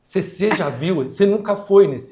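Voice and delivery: começa contida e sobe até gritar; desabafo indignado. Retold or imitated. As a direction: cresce até o grito no final. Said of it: "ela virou pra ele assim". (funcionária confronta o novo diretor, subindo até gritar)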